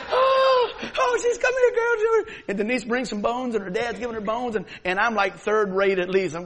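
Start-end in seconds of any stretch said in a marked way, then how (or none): 0.1-2.3 very high, loud delighted shout; excited greeting. Retold or imitated. Grandfather greeting his granddaughter's dog, very high, loud, delighted. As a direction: call out in joy to the dog.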